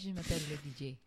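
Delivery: low, soft, sultry French introduction; sexy. Pretend. Co-host tries out the French introduction, sexy. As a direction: purr it softly as a playful French introduction. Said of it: "Sounds very sexy now".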